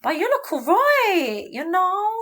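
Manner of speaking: high, gushing mock salon chatter; mocking hairdresser voice. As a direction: send up the hairdresser, sing-song and patronising. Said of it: "sort of making fun".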